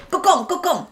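high, loud scolding cry; shrill scolding mother. Retold or imitated. Mom scolding her child while pulling her ear, high and loud.

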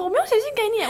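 pretending nothing happened; feigned calm. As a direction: play it cool but too high. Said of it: "我还要装得很镇定".

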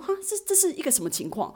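high, baffled disbelief. Retold or imitated. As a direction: ask it high and stunned, as if you can't follow. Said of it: "一头雾水".

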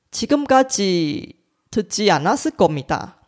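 ordinary delivery

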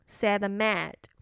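usual speaking voice

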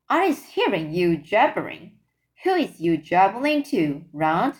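usual speaking voice

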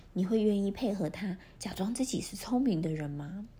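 usual speaking voice

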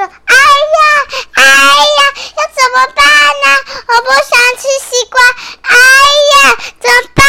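high, loud panicked wail; panicked child. Acted. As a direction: cry out like a frightened little boy. Boy trapped in watermelon dream.

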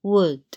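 would with no L sound; silent L. Shown as a demonstration. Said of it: "L不读出来的单字还有". (would的L不读出来)

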